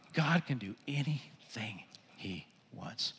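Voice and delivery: slow whisper, word by word; awed whisper. Real, not acted. Preacher repeats God's power to congregation, slow whisper. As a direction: whisper it slowly in wonder.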